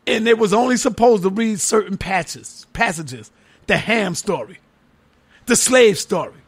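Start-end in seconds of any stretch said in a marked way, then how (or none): none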